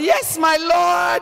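bow to him with a grin in the voice; playful submission. Retold or imitated. Wife answering her husband's call, playfully deferential. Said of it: "I'll come and I'll say".

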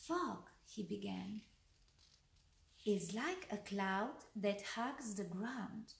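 lively bedtime-tale narration; story read to children. A narrator's reading. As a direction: read a picture book aloud, pausing between lines.

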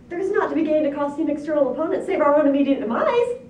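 high, loud frantic warning; squealing pig. A narrator's reading. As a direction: warn in a panicked pig squeal, rising at the end. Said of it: "squealed two".

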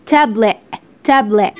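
tablet with the final t swallowed into a glottal stop. Shown as a demonstration. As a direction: say tablet as "tabley" with a catch at the end. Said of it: "بس ممكن تنطقه كده". (tablet بتاء مبلوعة في الآخر)

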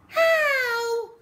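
put-on high, loud child's shriek. A narrator's reading. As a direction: shriek it like a small boy. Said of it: "shrieked Peter".

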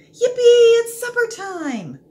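high, loud joyful squeal; a dog bursting with delight at supper; excited yelp. A narrator's reading. Dog squealing with joy as food rattles in.

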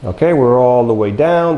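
ordinary delivery

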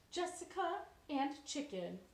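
usual speaking voice

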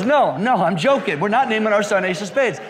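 high, loud mock backpedalling; frantic protest. Wife refusing to name son after a card, frantic protest. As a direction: imitate urgently taking back a joke.

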